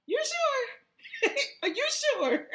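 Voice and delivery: high, laughing disbelief; anxious. Retold or imitated. To her partner mid-trip, asking high and laughing. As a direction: ask again, half laughing and unsure.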